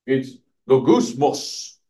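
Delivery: "logusmos" said slowly in a changed voice; murmured Greek word. Shown as a demonstration. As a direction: draw out "logusmos" like a grumble. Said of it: "you have to say it like that".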